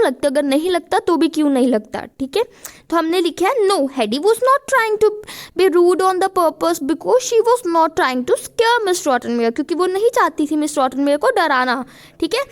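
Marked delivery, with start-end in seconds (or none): 3.7-9.5 slow, loud, careful dictation of the answer; slow dictation, louder. Shown as a demonstration. Teacher to students, dictating the answer slowly and loudly. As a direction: spell it out slowly so they can write.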